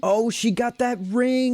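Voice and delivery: high, drawn-out mock-impressed echo; singsong echo. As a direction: stretch it out high and playful. Teasing echo of the spider.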